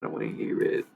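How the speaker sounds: a droning, sulky mock of reluctant clients; mocking whiny people. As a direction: parody people who refuse to look. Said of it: "people are like".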